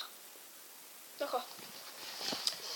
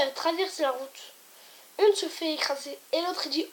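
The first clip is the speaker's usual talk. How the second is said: ordinary delivery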